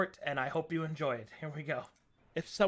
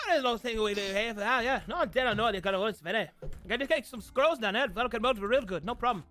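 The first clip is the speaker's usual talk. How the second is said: put-on thick Pikish accent, higher and fast; 4 semitones higher, faster than usual. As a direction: rattle it off in a Pikish accent, pitched up. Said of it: "That was me doing a Pikish accent".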